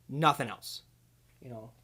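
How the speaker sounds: normal talking voice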